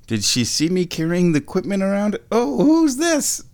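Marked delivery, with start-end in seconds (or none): 2.3-3.4 very high, slow cat voice; squeaky voiced pet thought. Voicing the cat Pippa.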